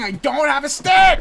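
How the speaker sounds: high, loud, drawn-out denial; indignant shout. Real, not acted. Player denies teammate's accusation of lying, indignant shout. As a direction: shout your innocence, slowly.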